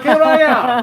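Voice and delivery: loud, high hammed-up Transylvanian accent; a campy vampire accent, booming. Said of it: "do it in your Transylvanian voice".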